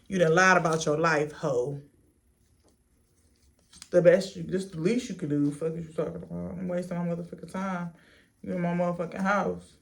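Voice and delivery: usual speaking voice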